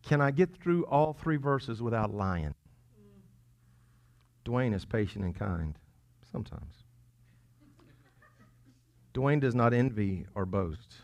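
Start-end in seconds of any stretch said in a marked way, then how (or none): 4.5-11.0 lower, halting reading with doubtful pauses; hesitant, self-mocking recital; doubtful self-test. Shown as a demonstration. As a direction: read it as if unsure it's true. Testing verse with own name, doubtfully.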